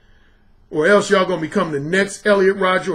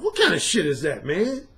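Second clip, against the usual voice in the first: ordinary talk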